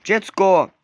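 high, loud panicked search; frantic yell. Real, not acted. Searching for baby, yelling. (głośny, spanikowany krzyk za dzieckiem)

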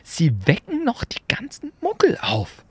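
a crisp teacher's question; McGonagall. A narrator's reading. McGonagall checking on Hagrid, crisp and stern.

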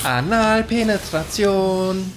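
very slow, high and loud; drawn out. Pretend. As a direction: stretch each syllable out. Drawn-out repeated punchline.

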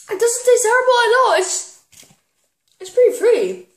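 high, loud, quick shouts of joy; thrilled cheering. Real, not acted. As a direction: cheer at the lucky pick. Delighted the bean is juicy pear.

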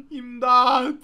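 desperate call to the team; shouting for help. Real, not acted. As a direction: yell for rescue. Calling teammates for help.

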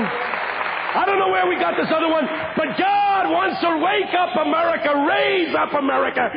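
high, ringing preacher's call; preaching at full force. Real, not acted. Preacher rousing young people, full-voiced preaching. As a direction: lift the room with a rising sermon cry.

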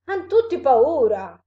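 loud, astonished monster; taken aback. A narrator's reading. Monster sees everyone flee the mouse, taken aback. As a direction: exclaim it in loud bewilderment. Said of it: "disse il mostro interdetto".

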